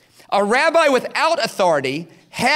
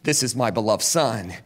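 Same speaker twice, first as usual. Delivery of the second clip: much lower, softer voice of God; voice from heaven; 10 semitones lower, 3 dB quieter than usual. Retold or imitated. The Father speaking over Jesus from heaven, low and grave. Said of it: "until a second voice speaks".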